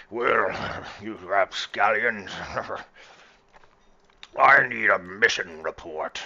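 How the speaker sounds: put-on loud, slurred drunken captain, drawn out; Greybeard, drunken. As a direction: slur it loudly like a tipsy old captain addressing the crew. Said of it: "do my best drunken Greybeard voice".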